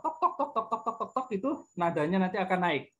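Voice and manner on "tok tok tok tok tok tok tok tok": tok tok tok, clipped knocking sounds; mock hammer knocks. Shown as a demonstration. Teacher imitates hammering the gamelan from below, knocking sounds. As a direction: tap out the hammer beats with your voice. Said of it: "Kalau dipukul dari bawah".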